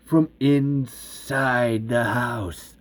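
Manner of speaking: low, slow, suspenseful reveal; ominous reveal. A narrator's reading. Narrator revealing where the screams come from, ominous. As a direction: space the words out for dread.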